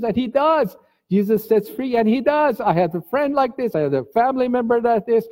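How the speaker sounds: high pleading protest; high voice appealing to the judge; retold shouting. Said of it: "just started shouting".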